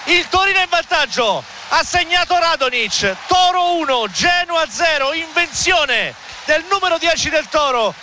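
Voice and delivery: high-pitched, shouted goal call; breathless italian goal commentary. Real, not acted. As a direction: erupt over the late goal. Live goal call in stoppage time.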